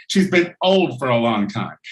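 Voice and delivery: ordinary talk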